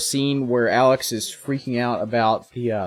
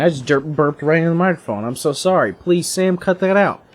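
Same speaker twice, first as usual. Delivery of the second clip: ordinary talk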